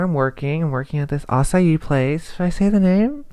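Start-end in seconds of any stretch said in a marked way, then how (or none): none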